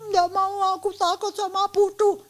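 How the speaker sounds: put-on high-pitched little kid voice; frightened child. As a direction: whine a refusal as a scared child. Voicing child refusing school. (suara anak kecil takut, melengking)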